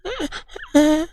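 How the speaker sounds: high-pitched, hammed-up panicky breaths; mocking high whimpery panting; mock Blair Witch panting. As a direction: imitate staged horror-film hyperventilating. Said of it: "he's literally doing the, uh, Blair Witch bullshit".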